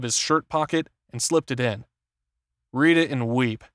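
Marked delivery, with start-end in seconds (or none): none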